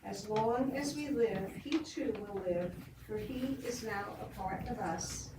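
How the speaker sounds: memorial reading. Acted. Leader closing the remembrance reading for mourners, hushed. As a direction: read it as a quiet closing verse.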